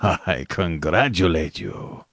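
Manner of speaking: slow, low character voice of the general; smiling general. A narrator's reading. General concedes to his quarry, slow and smiling. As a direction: concede slowly, with a low, composed smile. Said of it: "sucked in his breath and smiled".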